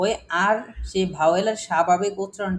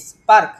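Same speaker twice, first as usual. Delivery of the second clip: "Spark" said loud and clear; "spark" modelled loudly, R silent; emphatic model word; 3 semitones higher, 10 dB louder than usual. Shown as a demonstration. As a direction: punch out "spark" for the students. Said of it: "এটার উচ্চারণটা একটু খেয়াল করেন".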